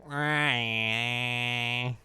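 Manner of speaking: whiny singer imitation; mock a whiny drawn-out vocal sound. Host mocking the Blink singer's vocals, whiny imitation. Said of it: "his weird whiny voice".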